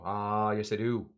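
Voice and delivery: lightly imitated teacher's accent, long opening "Ah"; Bob Heaps accent. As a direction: answer yourself with a drawn-out "Ah". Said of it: "He would do a little different".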